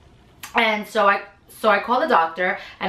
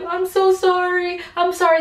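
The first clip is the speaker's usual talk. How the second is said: embarrassed apology; 10 semitones higher than usual. Retold or imitated. Gush in hospital lobby.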